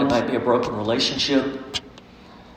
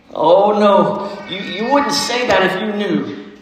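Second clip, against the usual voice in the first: higher, tearful fawn voice; a faun choking out his shame; a touch of mock sobbing, higher; between sobs; 5 semitones higher than usual. A narrator's reading. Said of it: "replied Mister Tumnus between his sobs".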